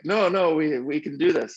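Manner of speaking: high-pitched, lightly imitated French accent. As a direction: protest as the French friend, accent on. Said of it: "I'll do my best French accent here".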